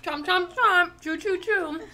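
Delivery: playful chomp chomp chew noises; chomping sounds. Pretend. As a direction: mime chewing with mouth sounds. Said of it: "with your mouthful of pizza".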